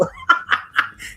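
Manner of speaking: cracks up hard. Real, not acted. Laughing at the story.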